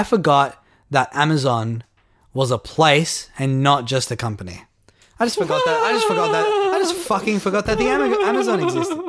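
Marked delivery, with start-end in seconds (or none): 5.2-9.0 high squeaky laughing disbelief. Real, not acted. Laughing disbelief at own forgetting.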